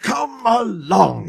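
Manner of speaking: slow, high calling out; an engine hurrying its train; puffing call. A narrator's reading. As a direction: urge the coaches along, chugging. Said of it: "he puffed".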